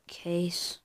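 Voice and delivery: whispering. Real, not acted. Shrugging off wasted move.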